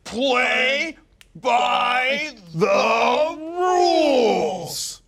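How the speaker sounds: defensive shout. Acted. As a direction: shout your innocence at the press. Player yelling back at host.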